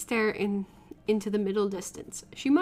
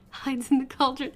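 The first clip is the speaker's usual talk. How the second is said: normal talking voice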